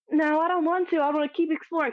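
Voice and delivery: put-on a thrilled kid who won't go yet; giddy excitement. Kid refusing mom in the dungeon, giddy and high. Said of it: "I'm giddy".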